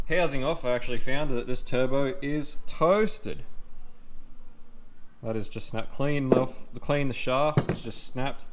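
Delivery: ordinary delivery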